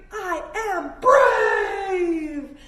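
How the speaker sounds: loud, high, very slow shout; shouted affirmation. Shown as a demonstration. Yoga teacher leading kids in a bold shout. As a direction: belt it out like a battle cry. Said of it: "and we're gonna say".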